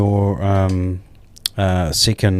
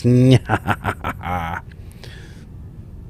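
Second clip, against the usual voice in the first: evil laugh; 2 semitones higher than usual. Acted. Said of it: "That's my evil voice".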